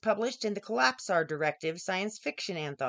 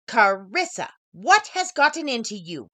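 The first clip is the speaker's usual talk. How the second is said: boss asking; 6 dB louder than usual. A narrator's reading. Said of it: "came right out and asked".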